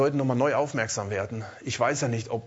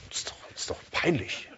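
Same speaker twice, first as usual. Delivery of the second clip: whispered, much softer, to himself; embarrassed whisper; 3 semitones lower, 8 dB quieter than usual. Retold or imitated. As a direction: hush it like a thought you're ashamed of. Retold own embarrassed inner doubt. (peinlich berührt flüstern)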